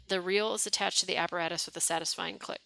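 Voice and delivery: usual speaking voice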